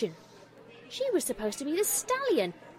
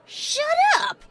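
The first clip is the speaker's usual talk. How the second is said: high, loud outburst; angry shout; 11 dB louder than usual. A narrator's reading. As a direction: shout down the bully. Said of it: "shouted Rainbow Dash".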